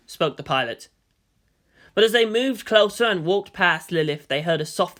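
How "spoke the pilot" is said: usual speaking voice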